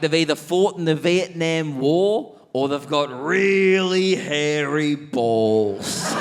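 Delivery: very slow, drawn-out boast; old bloke boasting. Retold or imitated. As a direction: stretch out hairy balls slowly. Jungle's punchline answer.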